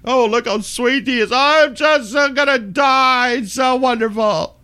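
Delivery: high, hammed-up tearful wail; mock weeping. Mocking weepy vloggers who turn on the waterworks, mock weeping. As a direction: fake the waterworks, high and drawn out. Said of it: "they just turn on the waterworks".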